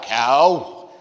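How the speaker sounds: "Cow" stretched out long; dragged out. Shown as a demonstration. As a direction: drag out the vowel in "Cow". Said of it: "where they drag it out".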